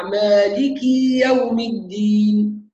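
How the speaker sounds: مالك stretched so a ya grows after the kaf; overlong kasra. Shown as a demonstration. Teacher showing students the overlengthening error. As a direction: drag the kasra of مالك into a ya sound. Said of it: "إشباع كسرة الكاف حتى يتولد منها ياء".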